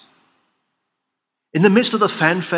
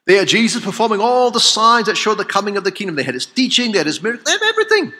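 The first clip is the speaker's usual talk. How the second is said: rosnąca irytacja; 7 semitones higher, 4 dB louder, faster than usual. Real, not acted. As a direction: list building to a high, loud peak. Preacher building a list of reproach.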